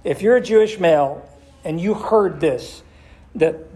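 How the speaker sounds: ordinary delivery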